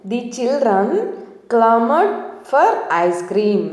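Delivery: very slow, each word spaced out; slow example sentence. Shown as a demonstration. Teacher reads the second example very slowly to learners. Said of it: "ఇంకొక example చూద్దాం".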